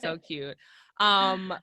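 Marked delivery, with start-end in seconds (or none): 0.0-0.6 softer, lower so cute, fond; soft fond repeat. Real, not acted. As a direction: repeat so cute gently to yourself. Repeating it softly.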